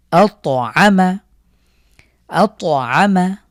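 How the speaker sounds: আত আমা slow and loud with the ত bounced; qalqalah on ত. Shown as a demonstration. Teacher slowly reciting আত আমা for students. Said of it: "ধাক্কা দিয়ে পড়ব".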